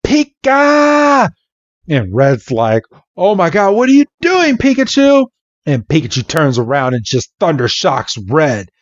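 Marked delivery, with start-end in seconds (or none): 0.0-1.3 high, sharp creature shout; hammed-up high battle cry; Pikachu cry. 3.1-5.3 high-pitched alarmed yelp; hammed-up frantic scolding; mock panic. Red panics at his Pikachu.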